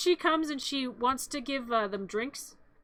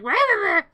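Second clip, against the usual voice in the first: mock cheer; 7 semitones higher, 6 dB louder than usual. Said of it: "some jerk named Gerald's like".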